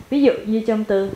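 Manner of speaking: ordinary talk, nothing marked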